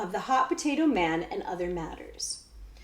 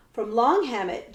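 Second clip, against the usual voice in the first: ordinary delivery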